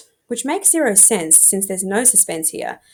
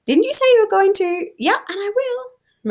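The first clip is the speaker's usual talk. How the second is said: voiced friend; 12 semitones higher than usual. Pushy friend vs high defensive reply.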